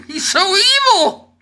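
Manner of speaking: very high squealed mock outrage; hammed-up pearl-clutching falsetto; mock horror. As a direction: squeal it as if scandalized. Mock horror at his taste.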